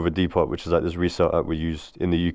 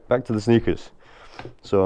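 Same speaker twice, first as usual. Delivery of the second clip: normal talking voice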